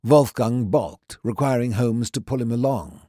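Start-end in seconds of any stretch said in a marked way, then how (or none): none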